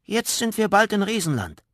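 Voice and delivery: hohe, laute Zwergenstimme; Zwerg verkündet. Acted. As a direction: kleiner Zwerg verkündet freudig. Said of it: "verkündete er". (Zwerg Goliath ruft seiner Freundin zu, hoch und laut)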